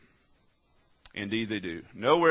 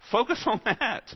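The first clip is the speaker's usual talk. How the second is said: loud, high preacher's exhortation; rally the congregation, full voice; fiery sermon shout; preaching; 14 semitones higher, 6 dB louder than usual. Acted.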